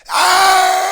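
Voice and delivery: long, high, loud scream; belligerent scream. Acted. Belligerent arguer losing it, long high scream. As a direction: scream it out at full volume. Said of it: "me being a belligerent".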